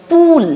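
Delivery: "পুল" with a rounded oo. Shown as a demonstration. Teacher giving an example word, "পুল" with oo. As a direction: sound out "পুল" with a clear oo vowel. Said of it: "সাউন্ডে উচ্চারণ".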